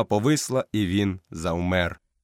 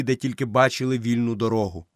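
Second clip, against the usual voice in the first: ordinary delivery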